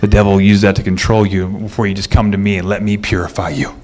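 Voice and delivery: tender God. Retold or imitated. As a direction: speak gently as God inviting them home. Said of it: "like Grandma, is sitting there".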